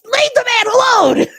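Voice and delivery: high, loud annoyed outburst; exasperated high-pitched yell; annoyed shout. Acted. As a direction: shout it out, fed up with the pestering. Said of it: "in a annoyed voice".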